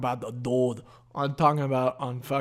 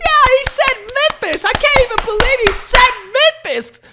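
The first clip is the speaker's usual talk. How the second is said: dumb crowd member; 12 dB louder than usual. Retold or imitated. Mocking the crowd cheering Memphis, dopey falsetto. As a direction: squeak like a dim audience member.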